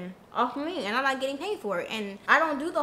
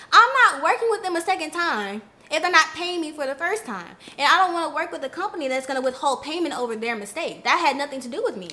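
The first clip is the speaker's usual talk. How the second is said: loud, higher, fed-up rant; heated refusal; 5 semitones higher, 6 dB louder than usual. Retold or imitated. Refusing the manager's push for free work, heated and loud. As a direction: push back hard and loud.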